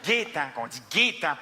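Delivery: Gaétan said with a closed Québécois "é", Gaé-tan; slow corrective Gaétan, Quebec style; Québécois pronunciation. Shown as a demonstration. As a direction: teach "Gaétan" the Québécois way, slowly. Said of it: "pas Gaëtan".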